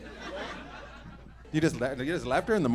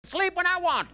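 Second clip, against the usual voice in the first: mock cranky woman; 10 semitones higher than usual. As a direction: whine it like a stubborn guest. Mocks the tired yawning woman.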